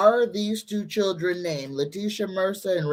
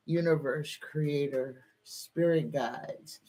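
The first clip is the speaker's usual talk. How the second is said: soft, low, slowing prayerful address; hushed invocation; 4 semitones lower, 8 dB quieter than usual. Real, not acted. Reader calling on spirit guides for an answer, hushed invocation. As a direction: call on them quietly, voice sinking. Invoking guides before a card reading.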